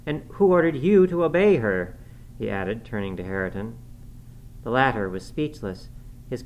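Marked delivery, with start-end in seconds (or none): 0.1-1.9 high, loud challenge; surprised demand. A narrator's reading. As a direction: demand an answer, voice jumping up. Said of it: "much surprised".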